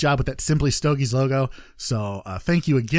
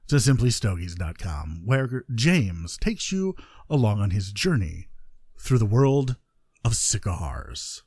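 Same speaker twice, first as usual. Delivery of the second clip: hammed-up promo voice; 7 semitones lower than usual. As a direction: drop low and draw out each phrase like a smooth promo. Hammed-up promo for cigar show.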